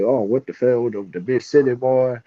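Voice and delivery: mock the big-city visitor in a thick rural country accent; rural hillbilly accent. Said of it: "talk like this".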